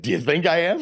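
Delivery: high, loud incredulous comeback; retold laughing reply. Fallen runner answering a stranger, retold laughing disbelief. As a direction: toss it back as a half-laughed retort. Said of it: "I think I laughed".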